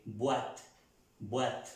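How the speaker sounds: "Boîte" as bwat, B clearly formed; well-pronounced B. Shown as a demonstration. Teacher drills the letter B for learners, "Boîte" as bwat. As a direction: model "boîte" alone, starting on B. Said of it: "pronunciando bien la letra B".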